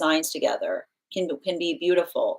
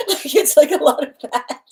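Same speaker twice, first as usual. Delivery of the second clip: laughing; 8 semitones higher, 8 dB louder than usual. Real, not acted. Laughing through the words.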